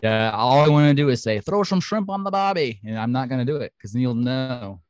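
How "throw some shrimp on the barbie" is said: high-pitched put-on Aussie accent; Australian caricature, raised pitch; mock Australian accent. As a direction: ham up an Australian twang, pitched way up. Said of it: "Then you'll know that I'm Australian".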